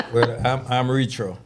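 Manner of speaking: ordinary talk, nothing marked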